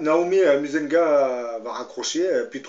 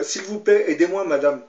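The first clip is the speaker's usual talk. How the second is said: higher, louder desperate plea for help; a retold caller's loud, pleading cry for help; 5 semitones higher, 7 dB louder than usual. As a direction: beg the operator as a sick woman in pain. Caller begging operator for help.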